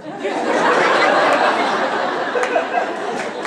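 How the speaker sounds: high airy burst of laughter; loud breathy cackle; big laugh. Real, not acted. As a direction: laugh hard at own punchline. Preacher laughs at punchline.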